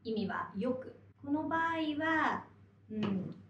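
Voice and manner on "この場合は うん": ordinary talk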